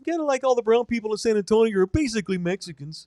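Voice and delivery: put-on high-pitched Wisconsin hockey accent; high, sing-song Midwestern creep. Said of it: "I got locked into characters".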